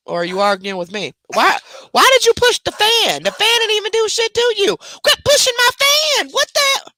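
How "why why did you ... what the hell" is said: high squeaky pet voice; voicing the dog. Owner voicing her dog scolding over the pushed fan, high squeaky. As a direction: speak for the dog, high and indignant.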